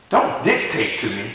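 ordinary talk, nothing marked

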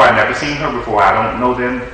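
ordinary talk

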